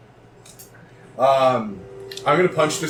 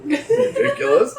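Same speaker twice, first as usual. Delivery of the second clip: laughing; slower than usual. Real, not acted. Player laughing at GM.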